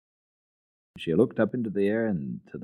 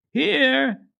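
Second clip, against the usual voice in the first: distant call; 7 dB louder than usual. A narrator's reading. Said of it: "sounded from some unknown, unseen place".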